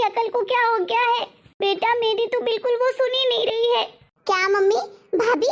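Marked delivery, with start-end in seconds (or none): none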